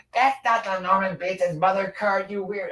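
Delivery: high-pitched mocking imitation; mimicked indignant objection. Streamer imitating a woman mad at a tarot reading, high mock outrage.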